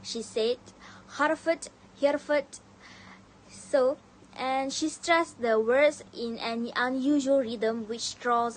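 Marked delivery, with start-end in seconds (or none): 1.2-2.6 "Hereford" with a loud, exaggerated H; overpronounced H. Shown as a demonstration. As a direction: punch the H at the start of "Hereford", louder and higher. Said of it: "Eliza overpronounced the H".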